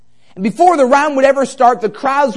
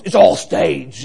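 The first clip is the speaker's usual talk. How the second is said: imitated deep-voiced wrestler; 6 semitones lower, slower than usual. Wrestler admitting the act to his seatmate, deep voice. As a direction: drop low to mimic the big wrestler. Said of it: "in that deep voice".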